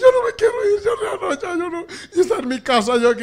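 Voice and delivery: high wailing sobbing plea; mock sobbing bride, high and loud; crying bride. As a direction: wail like a bride who won't leave home. Crying bride refuses to leave.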